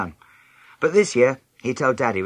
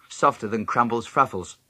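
plain reading voice

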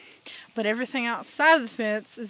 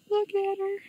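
cooing; 9 semitones higher than usual. Real, not acted. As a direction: coo over a sleeping kitten. Cooing at sleeping kitten.

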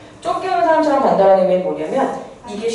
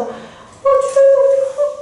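student glued to a TV drama, much higher; hooked drama viewer. Retold or imitated. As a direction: gush over a juicy TV scene. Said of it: "막 이러고 있는 게".

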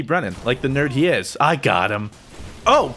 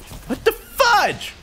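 much higher and slow, drawn out; stunned disbelief; much slower than usual. Real, not acted. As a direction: stretch it out in disbelief. Disbelief at vanished enemy.

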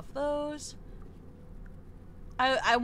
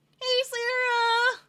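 high bright greeting; 11 semitones higher, 5 dB louder, slower than usual. Real, not acted. Greeting chat viewer.

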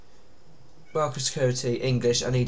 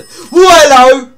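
high, very loud, drawn-out yelp; shrieked, slow startled greeting; startled yell; 12 semitones higher, 18 dB louder than usual. Real, not acted. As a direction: cry out in sudden shock. Startled while playing horror game.

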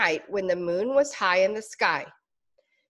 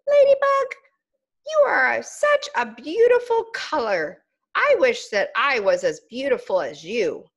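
small high caterpillar voice, wistful and admiring; a tiny bug gazing up in awe; admiring little caterpillar; 4 semitones higher, 4 dB louder than usual. Acted. Caterpillar to ladybug, high and wistfully admiring.

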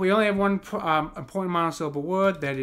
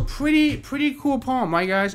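excited; 9 semitones higher, 5 dB louder than usual. Real, not acted. Praising the poem.

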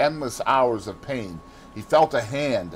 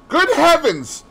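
loud, high-pitched exclamation; high, forceful outcry; shocked exclamation; 8 dB louder than usual. A narrator's reading. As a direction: burst out in alarm at your own blunder. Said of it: "exclaimed Sir Geoffrey".

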